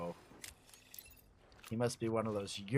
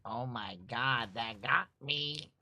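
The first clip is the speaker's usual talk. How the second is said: usual speaking voice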